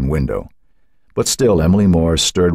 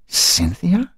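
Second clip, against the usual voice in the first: high, frail call into the night; an old woman calling out to a lost voice; 14 semitones higher than usual. A narrator's reading. Old woman calls to a memory.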